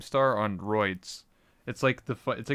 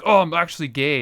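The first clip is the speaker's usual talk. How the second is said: louder, higher mock reveal; hammed-up confession; twist reveal; 5 semitones higher, 9 dB louder than usual. Talk show guest blurting a twist, loud mock voice. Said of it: "sudden turns of like".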